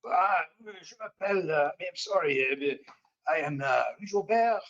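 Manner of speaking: put-on French Canadian accent giving his name; the prisoner gives his name in a French Canadian accent. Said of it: "French Canadian, uh, voice".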